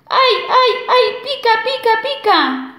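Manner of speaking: high yelping cries, mouth burning; a wolf howling as the mint stings; high, pained wolf yelps from strong mint. Acted. Wolf burned by extra strong mint.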